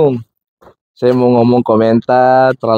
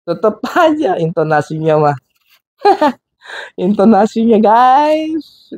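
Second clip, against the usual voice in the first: amused disbelief; 11 semitones higher than usual. Real, not acted. Mocking preacher's tone after laughing.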